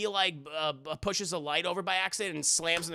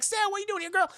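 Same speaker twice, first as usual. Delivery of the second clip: surprised [name]; 14 semitones higher, much faster than usual. Retold or imitated. [name] greets Sarah.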